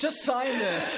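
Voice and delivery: high, slow mock order; imitating aide. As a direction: push the paper over mockingly.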